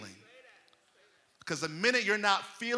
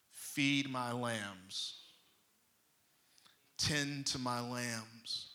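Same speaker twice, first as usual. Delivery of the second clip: slow, low, soft instruction; calm command; 6 semitones lower, 9 dB quieter, much slower than usual. Retold or imitated. Jesus charging Peter, in a slow, quiet command. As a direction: say it slowly and quietly, leaving space.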